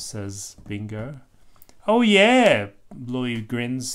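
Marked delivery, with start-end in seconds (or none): 1.9-2.7 high, loud bright "oh yeah"; a kid catching on with glee; happy realization. Acted. Bluey realizes.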